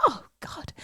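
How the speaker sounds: unvoiced, barely-there groan; whispered dread. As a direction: breathe it out in dread. Retold inner dread.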